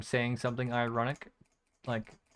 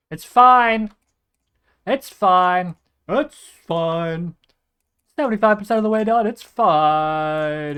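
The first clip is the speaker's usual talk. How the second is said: forced reassurance; 4 semitones higher, 12 dB louder, slower than usual. Real, not acted. Strained loud coping repeat.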